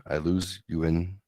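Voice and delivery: normal talking voice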